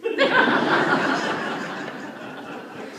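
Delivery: a big burst of real laughter; loud laugh. Real, not acted. Eulogist laughing at the memory of the reply. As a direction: break into loud laughter at the punchline. Laughs at her own story.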